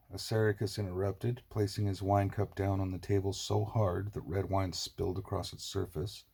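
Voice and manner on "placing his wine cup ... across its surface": plain reading voice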